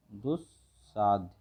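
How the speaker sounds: दुस्साध्य slowly in two parts, dus, saadhya; word broken up. Shown as a demonstration. Teacher dictates the correct word to students, broken up slowly. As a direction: spell out दुस्साध्य by ear, pausing after dus. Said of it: "दुस्साध्य होता है".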